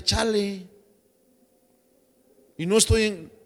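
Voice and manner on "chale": "chale" drawled in street slang; barrio slang. Shown as a demonstration. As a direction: drop into barrio talk on "chale". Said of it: "Yo era bien chale". ("chale" dicho con tono de barrio)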